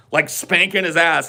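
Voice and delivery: ordinary delivery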